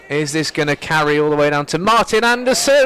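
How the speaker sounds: building up as the ball drops into hand; excited commentary. Real, not acted. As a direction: voice leaps high and loud at the catch. Catch commentary, rising to a climax.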